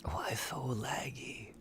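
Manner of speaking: drawn-out, breath-only whisper; hushed, slow lament; whispered complaint. Real, not acted. Mock complaint about game lag.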